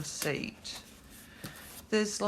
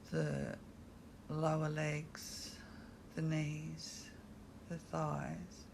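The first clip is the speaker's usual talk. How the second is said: guided meditation; 2 semitones lower, 8 dB quieter than usual. Acted. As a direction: guide them gently through the body, leaving space between phrases.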